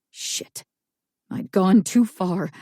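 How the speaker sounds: ordinary delivery, nothing marked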